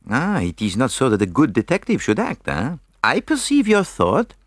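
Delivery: put-on high, animated French-accented lecture; mock their idea of detective work, tagging on eh; mocking Belgian detective. A narrator's reading. Poirot mocks energetic detectives.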